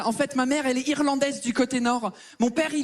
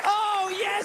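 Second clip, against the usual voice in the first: crowd hype shout; 9 semitones higher, much slower than usual. Real, not acted. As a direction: pump up the room. Comedian hypes crowd on entry. (cri d'accueil au public)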